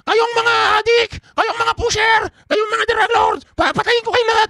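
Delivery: high, slow furious tirade; shouting high-pitched Duterte rant; Duterte impression. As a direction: rant at the crowd like an angry strongman. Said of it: "Ganitong gagawin niya".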